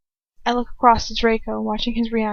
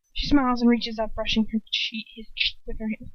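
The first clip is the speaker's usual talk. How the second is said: ordinary delivery, nothing marked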